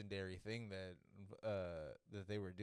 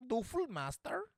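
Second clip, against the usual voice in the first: high-pitched mock German accent; questioning, badly faked German accent; 13 semitones higher, 4 dB louder, slower than usual. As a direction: attempt a German accent and miss. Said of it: "That's not a good German accent".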